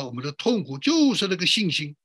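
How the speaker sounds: ordinary delivery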